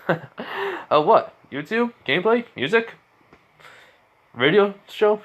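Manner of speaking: baffled that she knows every show; surprised questions. Acted. Radio demon surprised by his fan Jiro, high and bewildered.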